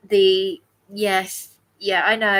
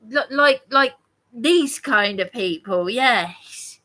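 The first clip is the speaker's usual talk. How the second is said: normal talking voice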